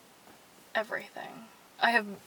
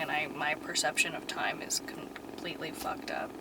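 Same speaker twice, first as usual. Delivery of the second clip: whisper; 6 semitones lower, 8 dB quieter than usual. Real, not acted. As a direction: lower whispered aside. Confessing aside.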